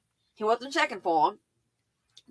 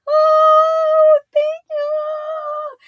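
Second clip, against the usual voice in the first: mock coy woman, much higher and louder; high sing-song thank you; 10 dB louder, much slower than usual. Storyteller imitating the flattered woman's sing-song thanks. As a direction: squeal the thanks like a flattered lady.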